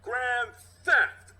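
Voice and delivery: high, loud, sure of himself. Acted. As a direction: say it like the case is closed. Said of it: "then more reassured".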